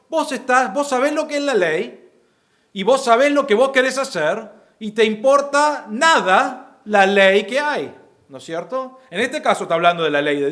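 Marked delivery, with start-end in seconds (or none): 0.1-8.0 defiant sinner. Retold or imitated. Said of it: "Él está diciendo".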